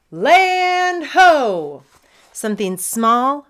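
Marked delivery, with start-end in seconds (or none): 0.1-1.8 loud, high, long-drawn call; full-voiced lookout's shout, pitched high; pirate lookout call. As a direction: holler it out across the sea.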